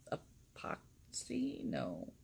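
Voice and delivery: slow unsure whisper to herself; whispered guess. Real, not acted. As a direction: wonder aloud under your breath.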